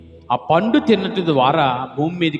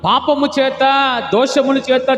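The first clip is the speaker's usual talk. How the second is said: full-force preaching; 8 semitones higher, 4 dB louder than usual. Real, not acted. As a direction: cry it out over the congregation.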